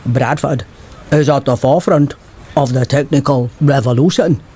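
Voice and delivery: measured, statesmanlike pronouncement; Churchill. Retold or imitated. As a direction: mimic a famous wartime orator's speechmaking. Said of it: "when Churchill visited the Alhambra's opening".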